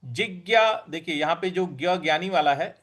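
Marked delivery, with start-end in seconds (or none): 0.0-0.9 slow जिज्ञा, syllable by syllable; careful model word; slow syllable demo. Shown as a demonstration. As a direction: say जिज्ञा slowly. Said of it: "अगला पद है".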